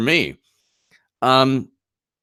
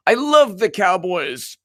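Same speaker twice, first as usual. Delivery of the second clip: mock politician restraint; 5 semitones higher than usual. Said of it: "no one speaks like that".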